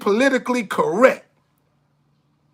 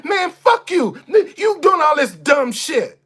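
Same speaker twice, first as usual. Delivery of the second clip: higher, shouted defiance; defiant cuss out; 4 semitones higher, slower than usual. Retold or imitated. Telling off someone doing dumb shit, shouted. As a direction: yell it with no fear. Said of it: "Somebody that ain't scared to say".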